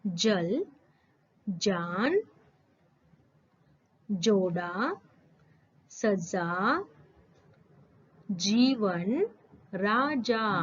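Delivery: each word spaced out, with a clear j in जल and राजा; careful ja words. Shown as a demonstration. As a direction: say जल, जान, जोड़ा slowly, with a clear dzh. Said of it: "मैं कैसे बोल रही हूँ उसको अच्छे से सुनिए".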